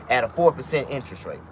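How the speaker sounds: ordinary delivery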